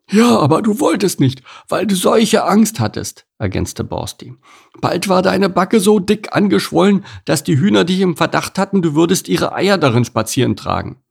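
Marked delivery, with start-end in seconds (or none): 0.1-3.3 high, complaining pig character; put-on pig voice, aggrieved and pitched up; grumpy pig. A narrator's reading. 4.8-11.0 high-pitched pig character, chipping in; put-on pig voice, lively; animated pig. A narrator's reading.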